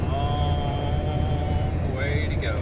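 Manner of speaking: slow, drawn-out mock-dramatic line; dramatic drawl. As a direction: stretch it out like a movie trailer. Dramatic desert trip voiceover.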